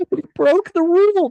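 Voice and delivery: very high, loud pretend horror; mock shock. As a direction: squeal it as if scandalized. Pretends shock at broken rule.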